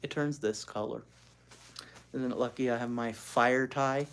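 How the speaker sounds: ordinary delivery, nothing marked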